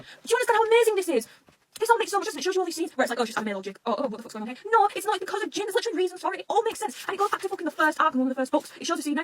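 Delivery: can't contain the excitement; excited gushing. Real, not acted. Telling viewers how the plot ties together, excited gushing.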